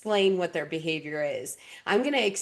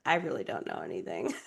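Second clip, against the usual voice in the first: low, quick, mumbled mock confession; mock low mumble; 11 semitones lower, 7 dB quieter, faster than usual. Mocking degree holders who admit knowing nothing, low and quick. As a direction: drop low and mutter it fast.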